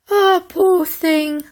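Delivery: slow, yawning mock pity; sarcastic sigh of pity; mock sympathy. A narrator's reading. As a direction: drag it out like you're bored.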